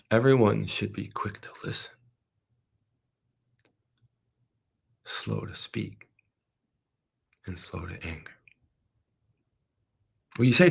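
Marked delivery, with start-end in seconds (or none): 0.1-8.3 soft scripture reading. Acted. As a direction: read the verse gently and let it die away. Preacher reads the verse to congregation.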